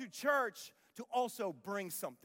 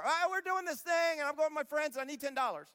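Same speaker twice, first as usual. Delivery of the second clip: put-on teen begging; 6 semitones higher than usual. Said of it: "What do you need it for?".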